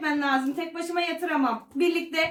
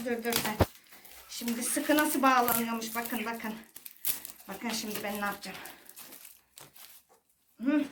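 quiet muttering; 8 semitones lower, 8 dB quieter than usual. Real, not acted. Quiet tying, low voice.

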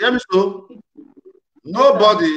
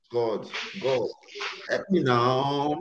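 prayer; 6 semitones lower, 10 dB quieter, much slower than usual. Real, not acted.

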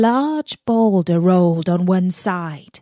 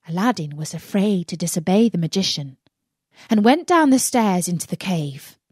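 ordinary delivery, nothing marked

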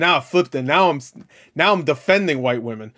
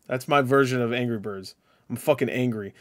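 ordinary delivery

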